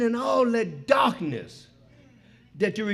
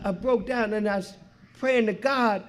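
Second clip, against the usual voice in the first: usual speaking voice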